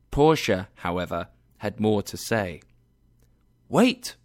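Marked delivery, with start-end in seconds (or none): 3.7-4.1 sharp high cry of wait; exclaimed. A narrator's reading. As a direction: stop them with a sudden high call. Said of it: "she said again".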